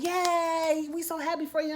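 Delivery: high, mock-happy cheer. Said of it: "And it's like".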